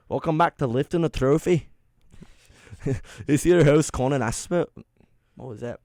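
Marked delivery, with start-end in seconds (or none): 0.1-4.7 put-on Scottish-accented radio host intro; host the show in a mock Scottish accent. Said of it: "Scottish accent was always pretty good".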